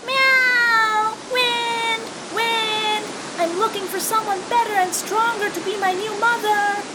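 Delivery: high, loud kitten call up to the sky; kitten calling loudly. Acted. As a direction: shout up to the sun in a high kitten voice. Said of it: "and called out".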